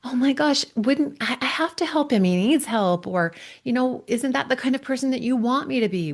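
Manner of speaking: high, rushed, defensive protest. Retold or imitated. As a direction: justify yourself in a flustered rush. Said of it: "What we would normally wanna do is to defend".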